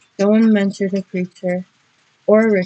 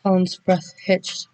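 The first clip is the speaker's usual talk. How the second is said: usual speaking voice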